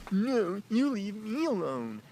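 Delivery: high, slow mocking comeback; put-on high voice, mock defiance; mimicked woman. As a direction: mimic her snapping back in a drawn-out high voice. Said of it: "She's like".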